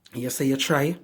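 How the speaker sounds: ordinary talk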